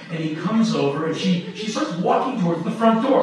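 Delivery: ordinary talk, nothing marked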